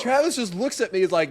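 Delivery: normal talking voice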